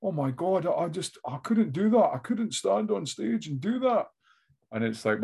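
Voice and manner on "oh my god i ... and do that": awed onlooker. Retold or imitated. People reacting to a stand-up comic, mimicked as awed. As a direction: put on an amazed punter's higher voice. Mimics impressed audience member.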